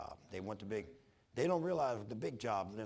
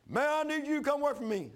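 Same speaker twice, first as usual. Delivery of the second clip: much higher put-on voice for Jack; imitating Jack; 7 dB louder than usual. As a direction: mimic the pushy friend in a higher voice. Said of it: "he goes".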